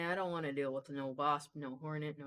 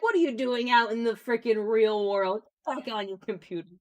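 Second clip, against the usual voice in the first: mock disbelief; 4 semitones higher, 9 dB louder than usual. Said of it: "and you're just like".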